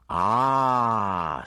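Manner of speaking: a monster savouring a new target; dragon voice. Acted. The dragon turns to Geneva with a looming drawn-out sound.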